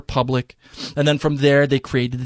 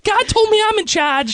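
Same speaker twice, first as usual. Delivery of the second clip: squeaky mimicry; 3 dB louder than usual. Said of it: "they say it like that".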